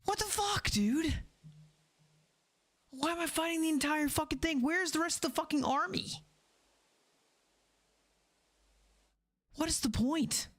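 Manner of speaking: high-pitched disbelieving rant; exasperated yelling. Real, not acted. Gamer left alone fighting the whole army, high exasperated outcry. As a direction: cry out where everyone went.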